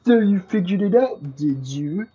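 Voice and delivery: haughty, pompous buffoon; bumbling fool. Acted. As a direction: sneer it like a self-important fool. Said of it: "haughty buffoon voice".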